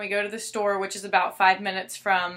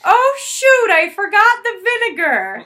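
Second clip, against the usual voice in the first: much higher, louder mock-flustered mom voice; imitating mom; 12 semitones higher, 11 dB louder, slower than usual. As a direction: play the mom, loud and fake-surprised. Mom's staged fake conversation retold.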